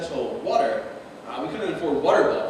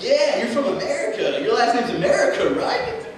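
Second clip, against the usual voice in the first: sarcastic comeback; 7 semitones higher than usual. Retold or imitated. Answering the bullies, high sarcastic comeback. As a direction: fire it back sarcastically.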